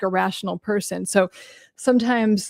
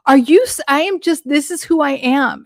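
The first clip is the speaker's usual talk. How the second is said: high, loud mock outrage; hammed-up indignant squawk; mad, defensive; 8 semitones higher, 4 dB louder than usual. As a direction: imitate an affronted woman protesting when called out. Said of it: "She was so mad".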